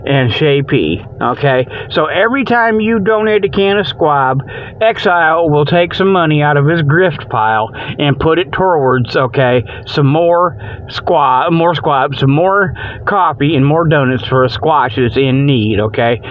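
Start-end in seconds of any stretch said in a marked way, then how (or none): none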